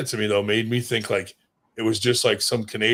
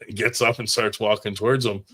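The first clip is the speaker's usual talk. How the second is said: normal talking voice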